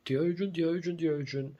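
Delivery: put-on high sing-song child voice; mock childish play. Mocking grown men who play pretend, high childish chant. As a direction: play like a silly kid with a toy. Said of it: "I never go like".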